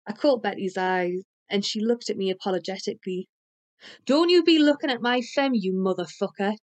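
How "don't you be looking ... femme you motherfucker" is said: high, loud start, falling away; angry sputter. A narrator's reading. Jealous Tony warning the narrator off, angry outburst. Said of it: "Tony sputtered".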